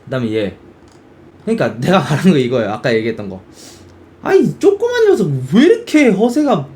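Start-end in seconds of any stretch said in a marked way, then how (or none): 4.2-6.7 high, put-on teasing voice; 허세를 놀리는 연기. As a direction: act out mocking a little show-off. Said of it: "막 이 느낌". (높은 목소리로 얄밉게 놀리기)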